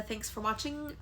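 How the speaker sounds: normal talking voice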